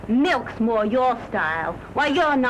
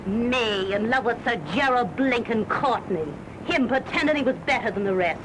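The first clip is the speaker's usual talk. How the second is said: ordinary delivery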